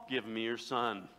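suddenly low, composed voice; calm. Acted. [name] hiding panic from everybody else, acted calm. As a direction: drop from shouting into mock composure. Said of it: "I'm gonna be calm".

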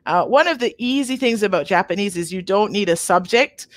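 usual speaking voice

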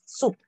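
SOUP said as a clear English word; pronunciation model. Shown as a demonstration. Teacher reminding student of the pronunciation of soup, modelling it clearly. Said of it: "Remember the pronunciation is".

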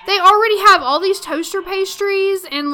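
ordinary talk, nothing marked

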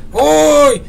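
high, loud drawn-out "uy"; startled cry. Real, not acted. Player begging for the artifact gets a scare, startled cry. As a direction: shriek of dismayed surprise.

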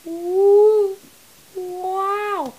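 high amazed ooh, wow; delighted ooh. Real, not acted. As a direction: coo in delight.

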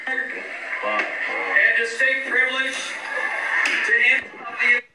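loud sobbing, drawn out. Real, not acted. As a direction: wail the words through sobs. Sobbing through words.

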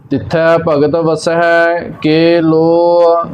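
slow, measured recitation on a level tone; scripture recitation. Acted. Teacher reciting Gurbani for learners, slow measured recitation. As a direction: recite line by line, drawing out the vowels.

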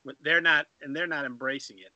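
ordinary delivery

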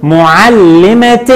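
معلمة very slowly, ending in "-tin"; tanween kasr ending. Shown as a demonstration. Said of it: "عند التنوين بالكسر بنقول".

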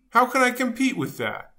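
usual speaking voice

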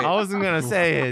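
defending himself through laughter; laughing protest. Real, not acted.

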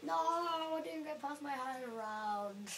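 groaning slow lament; drawn-out dismay. Real, not acted. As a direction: stretch out the disappointment. Dismayed at losing.

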